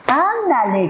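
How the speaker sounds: primera actriz. Retold or imitated. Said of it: "como la primera actriz".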